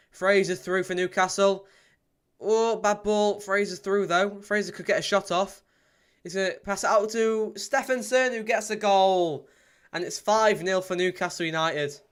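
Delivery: high, loud live goal commentary; excited play-by-play. Real, not acted. Fan commentating as Newcastle score their fifth, excited play-by-play.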